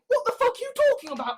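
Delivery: high, fast incredulous retort; high, quick mock disbelief. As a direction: fire it back fast and high, baffled. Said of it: "moderate Muslims saying".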